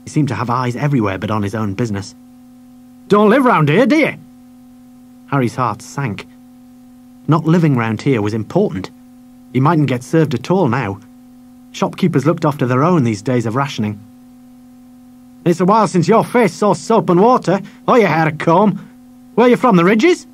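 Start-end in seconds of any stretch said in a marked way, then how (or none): 3.1-4.1 high, loud mocking question; sneering shopkeeper. Shopkeeper taunts the boy. 15.4-20.3 loud, high scornful jeer; scornful shopkeeper. Acted. Shopkeeper insults the boy.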